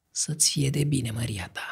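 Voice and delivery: softly whispered, fading. A narrator's reading. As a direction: breathe it barely audible. Poison handed over softly.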